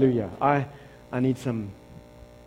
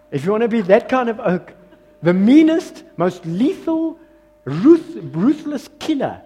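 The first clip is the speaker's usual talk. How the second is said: laughing, then louder and higher; preacher hyping the fiercest warrior; rising excitement; 5 semitones higher, 7 dB louder than usual. Real, not acted. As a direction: build it up with a grin. Building up to warrior king.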